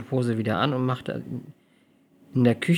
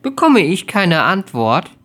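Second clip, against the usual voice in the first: louder, slow stern demand; stern rebuke; 6 semitones higher, 9 dB louder, slower than usual. Acted. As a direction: rebuke sharply, waiting for an answer. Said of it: "als Odin sie ermahnte".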